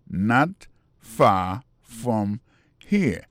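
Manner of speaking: very slow, each word on its own. Shown as a demonstration. Teacher repeats the new phrase for learners, word by word. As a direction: leave a clear gap after every word.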